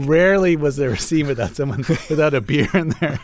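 words breaking up with laughter; laughing. Real, not acted. Interviewer teasing the writer about beer in every scene, laughing. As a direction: laugh through the end of the remark.

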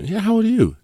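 childish mock echo. [name] taunts his costar by echoing how old are you? in a mocking high voice. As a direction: squeak you back like a taunting kid. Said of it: "I said".

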